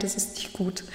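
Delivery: soft, hushed verdict; grave whisper. Retold or imitated. Retelling the doctor's bad news to listeners, hushed whisper.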